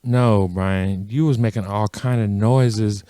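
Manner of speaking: low, slow mom voice; imitated knowing mom. Mom contradicting her son's excuse, low and slow. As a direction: correct him low and unhurried. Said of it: "my mom was like".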